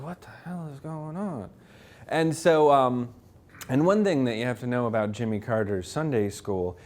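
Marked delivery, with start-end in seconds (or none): 0.0-1.5 under-the-breath confusion; muttered aside. Retold or imitated. Crowd wondering quietly what is happening, muttered. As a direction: mutter it to yourself, baffled. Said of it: "the whole time he's just like".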